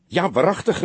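high, eager exclamation; enthusiastic. A narrator's reading. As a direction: cry out with delighted certainty, pitch way up. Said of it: "riep hij enthousiast".

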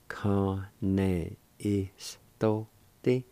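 Kane istote stretched very slowly, rising on istote; very slow demo. Shown as a demonstration. Teacher modelling slowly before normal speed. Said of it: "It is pronounced like this".

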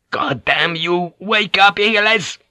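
high, strained shout to rouse him; angry shout. A narrator's reading. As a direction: yell at a man who won't wake. Captain roars at unconscious man.

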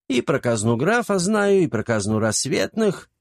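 put-on high, gleeful boasting old man; grinning old servant brags; sly old servant. A narrator's reading.

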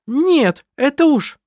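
high, loud indignant cry; protest, cut off mid-sentence; shocked protest, loud and high; indignant outburst. A narrator's reading. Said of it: "начала была Лисин".

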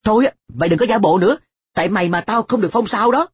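quick, high, irritated scolding; exasperated. A narrator's reading. Boy blames friend An for losing his star, exasperated and fast. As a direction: snap at a friend you blame. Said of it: "khiến tôi phát điên".

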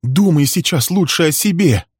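high, urgent woman's plea; anxious, insistent begging; pleading. A narrator's reading. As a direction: implore him, loud and high. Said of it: "умоляла его Берил".

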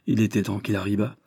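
plain reading voice

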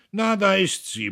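high-volume grumbling; grumbled. A narrator's reading. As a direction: grouse it out loudly. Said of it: "brummte Wulko".

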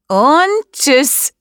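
high, sing-out goodbye; mock farewell. A narrator's reading. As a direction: send them off gleefully, pitch high. Boy throws girl into water. (hoch gerufenes, freches Tschüss)

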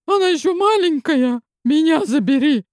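high, urgent croaked plea; hoarse plea. A narrator's reading. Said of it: "прохрипела я".

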